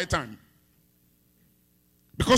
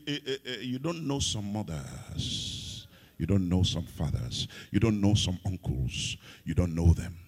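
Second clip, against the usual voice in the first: low warning; 13 semitones lower, 5 dB quieter than usual. Real, not acted. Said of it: "low ominous warning to congregation".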